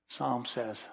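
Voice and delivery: ordinary talk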